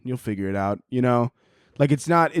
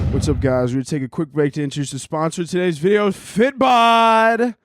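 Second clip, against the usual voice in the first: ad read; 3 semitones higher than usual. Acted. YouTuber launching into the sponsor spot, upbeat and fast. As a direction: pitch the sponsor hard.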